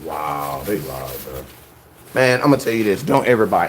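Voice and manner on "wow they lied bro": low, soft let-down; deflated, under the breath; disappointed. Real, not acted. Buyer finding the ad lied, deflated.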